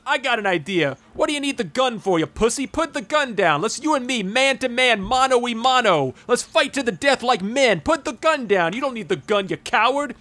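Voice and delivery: high-pitched mock goading; Ford Fairlane. Talking an armed assassin into a fistfight, high imitated taunting. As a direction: act out a cocky challenge, pitched well up. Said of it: "And he says".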